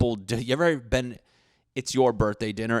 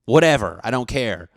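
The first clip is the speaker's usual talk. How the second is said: mock angry outburst; 3 semitones higher, 10 dB louder than usual. Embarrassed friend told about food in teeth, loud mock outburst. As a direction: blow up loudly in mock offense. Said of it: "And then they're like".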